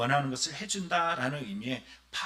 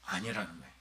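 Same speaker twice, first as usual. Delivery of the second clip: quiet denial; 7 semitones lower, 9 dB quieter, faster than usual. Acted. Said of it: "rhetorical answer to his question".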